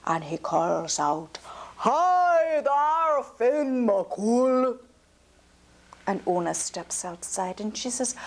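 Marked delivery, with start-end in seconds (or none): 1.8-4.8 louder, higher booming giant's call. Acted. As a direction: hail the house like a giant across the hills. Giant calls out to Finn.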